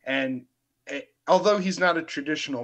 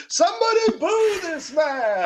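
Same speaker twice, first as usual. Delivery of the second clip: high shouted booing call; loud heckle; 14 semitones higher, 4 dB louder than usual. Pretend. Heckling co-host's joke.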